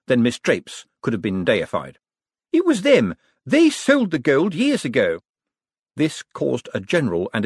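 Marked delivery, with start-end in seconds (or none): 2.5-5.2 high, loud denunciation; heated pointing-the-finger outburst; accusatory. A narrator's reading. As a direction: expose the culprits at full indignation. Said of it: "She pointed an accusatory finger".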